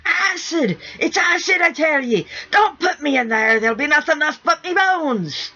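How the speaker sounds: high, loud, frantic pirate screaming; panicked scream. A narrator's reading. Sniff screaming at the crew dragging him to the bath, high and panicked. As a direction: shriek in terror of the tub. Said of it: "Sniff began to scream".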